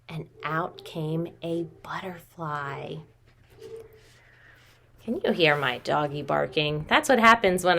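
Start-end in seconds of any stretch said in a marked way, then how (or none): 0.1-3.0 hushed whispered reveal. Acted. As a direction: whisper it like a little secret for the kids.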